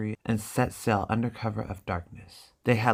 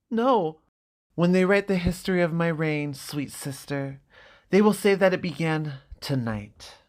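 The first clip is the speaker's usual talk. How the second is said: higher, proud proclamation; lightly voiced grandiose prince; grandiose brother; 7 semitones higher, 3 dB louder than usual. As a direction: announce your destiny to your sister. Viserys proclaiming his reign.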